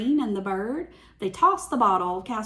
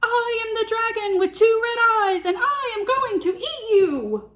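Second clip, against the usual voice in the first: much higher, loud hammed-up roar; biggest dragon roar; 11 semitones higher, 5 dB louder than usual. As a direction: roar as scary as you can. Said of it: "gave his biggest roar".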